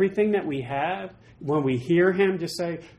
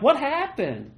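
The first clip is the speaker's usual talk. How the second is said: higher, bewildered exclamation; baffled self; 7 semitones higher, slower than usual. Retold or imitated. Preacher echoing God's question, higher and baffled. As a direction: ask it in plain disbelief. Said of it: "I'm like".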